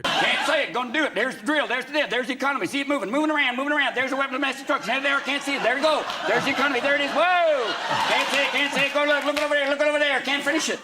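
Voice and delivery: high, loud goofy presidential patter; high Bush caricature. Retold or imitated. Bush miming invisible weapons, high and loud. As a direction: play the dim president pointing everywhere.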